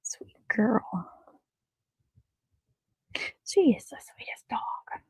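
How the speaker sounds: soft whisper of affection; whispering to dog. Real, not acted. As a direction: hush it out like petting the dog. Cooing softly to the dog.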